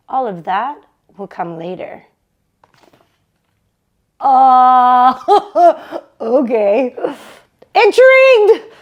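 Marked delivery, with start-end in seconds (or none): 4.2-6.9 real laugh breaking the reading. Real, not acted. Reader laughs at the chapter before going on. 7.7-8.7 high, loud delighted exclaim; excited. Real, not acted. Reader reacts to the chapter with delight. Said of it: "reader exclaims own reaction".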